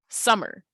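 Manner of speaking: "Summer" with SUM stretched, falling at the end; first-syllable stress. Shown as a demonstration. Teacher saying it regularly to show stress, stressed start of "Summer". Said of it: "stretches out even when you're saying it regularly".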